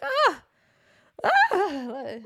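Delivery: high, loud put-on moans; hammed-up loud moaning; fake loud moan. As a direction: moan loudly to drown out the moment. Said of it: "a louder moan".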